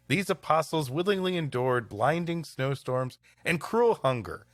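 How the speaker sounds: usual speaking voice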